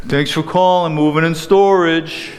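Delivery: a joyless sap answering the phone; miserable phone greeting. Shown as a demonstration. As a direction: mumble the greeting like you hate your job. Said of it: "Nobody wants to talk to a miserable sap".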